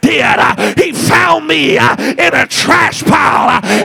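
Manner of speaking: loud, driving preaching with huffed uh breaths; full-force preaching. Acted. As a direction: shout it to the back pew.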